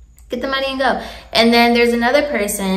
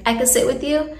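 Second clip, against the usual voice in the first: "I can sit with you" lifting on YOU like a question; questioning statement; 3 semitones higher, 4 dB quieter than usual. Shown as a demonstration. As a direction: state it, but let "you" rise at the end. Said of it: "say it like a question".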